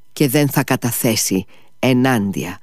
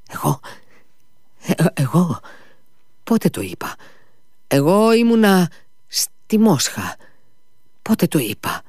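faltering, higher-pitched bewildered protest; lost stammering; 8 semitones higher than usual. A narrator's reading. Ivan denies having called himself the murderer, stammering. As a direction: stammer it out, completely at a loss. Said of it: "τράβλησε ο Ιβάν ολότελα χαμένος".

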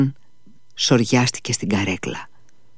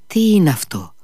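trembling; 6 semitones higher, 3 dB louder than usual. Acted. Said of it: "ρώτησε τρέμοντας".

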